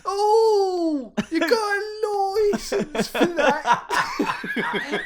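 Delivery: high, drawn-out British squawk through laughter; mock British accent. Host doing the British license joke, high laughing mock accent. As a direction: stretch it loud in a silly British accent. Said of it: "my favourite British joke ever".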